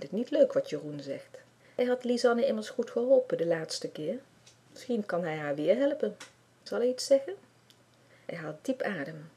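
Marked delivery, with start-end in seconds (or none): none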